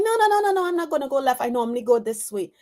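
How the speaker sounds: high rapid protest, settling down; mock stubborn refusal. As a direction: wave off the suggestion from up high, then ease down. Acted refusal of inner voice.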